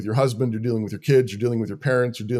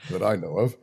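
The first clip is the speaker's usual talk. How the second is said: laughing; 5 semitones lower, 4 dB quieter than usual. Real, not acted. Joke about nobody planting weeds.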